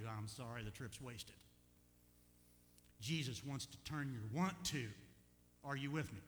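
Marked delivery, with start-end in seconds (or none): none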